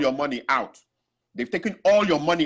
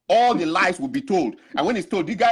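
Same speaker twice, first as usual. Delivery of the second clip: normal talking voice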